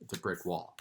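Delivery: usual speaking voice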